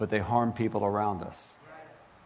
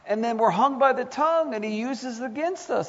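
high, impassioned preaching voice; rising sermon intensity; fervent preaching; 11 semitones higher than usual. Acted. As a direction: preach it up with fire.

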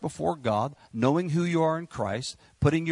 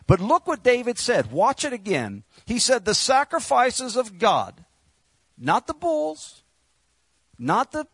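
full-force preaching; 5 semitones higher than usual. Acted.

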